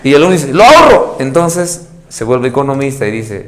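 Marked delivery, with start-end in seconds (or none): 0.5-1.2 high, loud "I'll save it"; proud little saver; excited decision. Retold or imitated. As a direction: pupil proudly deciding to save the coins. Pupil voiced deciding to save.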